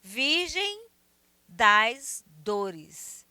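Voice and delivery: "Virgem das Dores" spaced out, a pause after "Virgem"; verse scanned slowly. Shown as a demonstration. Teacher reading the last verse, "Virgem das Dores" slow and divided. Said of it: "essa sequência de versos".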